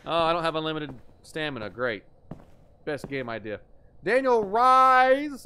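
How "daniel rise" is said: loud, high, stretched-out name; mock announcer. Streamer announcing a viewer as the judge, mock proclamation. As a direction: call it out slowly like a court crier.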